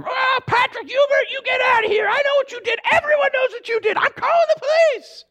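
put-on high, loud scolding old neighbor; shrill-free sharp scold of a nosy neighbor lady; angry neighbor lady. Neighbor lady chasing Patrick off, high loud scolding. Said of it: "And she said".

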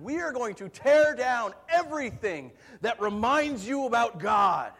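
loud, slow, high-pitched proclamation; yelling revolutionary. Retold or imitated. Revolutionary yells down at peasants from the cathedral, defiant yell. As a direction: yell it out to a crowd far below. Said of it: "he yells at the peasants".

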